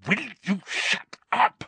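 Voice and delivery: high, tense hiss; strained, exasperated hiss; angry hiss. A narrator's reading. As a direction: hiss it urgently to silence him. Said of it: "Harry hissed".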